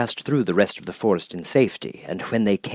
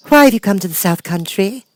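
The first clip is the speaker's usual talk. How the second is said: high, louder formal question; lightly voiced guard girl; 11 semitones higher, 5 dB louder than usual. Uniformed gate girl questioning Dorothy, high formal. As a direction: challenge politely at the gate.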